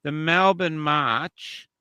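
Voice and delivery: "The Melbourne March" stretched slowly, rising at the end; slow rising title read; slow drawn-out announcement. Real, not acted. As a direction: drag it out like a headline. Repeats the march topic.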